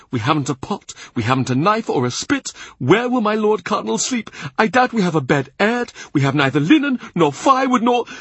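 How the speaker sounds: a flustered steward near panic; anxious, sweating. A narrator's reading. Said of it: "anxious, sweating".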